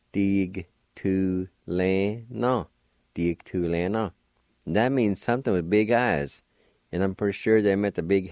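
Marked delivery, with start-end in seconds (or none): 0.1-2.7 "Dig tu le na" drawn out very slowly, falling on "na"; slow demo. Shown as a demonstration. Teacher slowly modelling the Cherokee word for learners. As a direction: stretch "Dig tu le na" long and even. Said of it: "like this if you want to".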